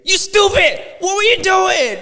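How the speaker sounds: loud, high furious yell; angry parent shout. Retold or imitated. A parent yelling at his kid after the golf club hit, loud furious shout. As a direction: scold at the top of your voice. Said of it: "I'm like".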